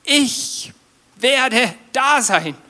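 high, slow, emphatic promise; God's promise. Retold or imitated. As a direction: say it slowly like God promising. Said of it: "Dann sagt Gott mit seinem Namen". (langsam und hoch: Gottes Versprechen)

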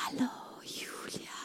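faint breathy whisper of a name; soft whisper. Shown as a demonstration. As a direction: whisper it like a gentle breeze. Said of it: "wie ein leises Säuseln".

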